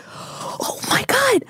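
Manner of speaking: breathless whispered oh my God; sudden stunned whisper; gasped whisper. Real, not acted. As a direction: gasp it out under the breath in shock. Sudden memory of dream.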